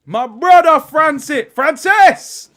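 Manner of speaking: high, loud Jamaican call to Francis, slowed; yard man accent. Retold or imitated. Streamer hails Francis in a loud yard man voice. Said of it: "Yard man says".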